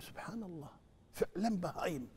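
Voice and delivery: softly, almost under the breath; hushed musing. Retold or imitated. Friend marvelling at the sheep, hushed. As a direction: murmur it in quiet wonder.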